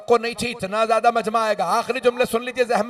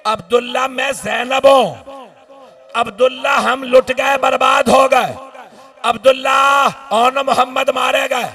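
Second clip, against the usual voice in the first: louder, crying-out recitation; loud mournful preaching; 4 semitones higher, 7 dB louder than usual. Acted. Preacher voicing Zainab to mourners, loud lament. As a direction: call out her grief to the hall.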